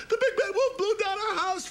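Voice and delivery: high, loud laughing squeal; scared pigs telling Fredo about the wolf; panicked pigs. Acted. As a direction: blurt it out in a giggling panic.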